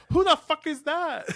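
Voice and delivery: high, loud shocked squeal; surprised. Retold or imitated. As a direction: shriek it in disbelief. Voicing a surprised character.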